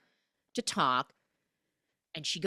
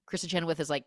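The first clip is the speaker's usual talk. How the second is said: ordinary talk, nothing marked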